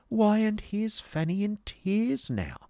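high, slow exclamation of surprise; drawn-out mock astonishment; feigned amazement. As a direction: act freshly astonished, pitched right up. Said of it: "as if in fresh amazement".

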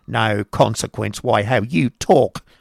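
slow, sharp retort; sharp wife correcting her husband. Acted. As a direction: cut him down sharply. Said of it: "retorted Missus Squeers sharply".

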